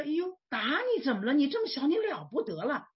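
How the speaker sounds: indignant mock scolding of a child; retold temper flare. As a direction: re-enact a mother's indignant comeback, pitched high and loud. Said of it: "那我这个性也来了".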